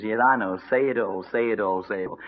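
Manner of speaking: acted hangdog acceptance; downcast resignation. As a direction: give in, voice falling with each repeat. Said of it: "just stood there with his head down".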